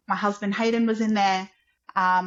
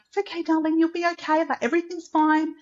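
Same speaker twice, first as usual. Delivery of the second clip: higher, reassuring midwife voice; student midwife calming the scared mother, higher and reassuring; brave-faced comfort; 7 semitones higher, faster than usual. Retold or imitated. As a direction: soothe her like a kind student midwife. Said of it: "she just put on a brave face".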